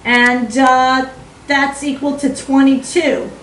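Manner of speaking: put-on chatty Aussie math tutor, playful accent; Australian accent. Math tutor walking students through an equation, Australian accent. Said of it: "now we're gonna leave Australia".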